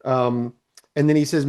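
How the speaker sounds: usual speaking voice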